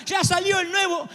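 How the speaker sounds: put-on high, slow wailing child's cry; crying spoiled child. Preacher mocks a son wailing to his father over the iPhone, high cry. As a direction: wail it like a spoiled kid denied a phone. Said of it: "y te dice, Papá".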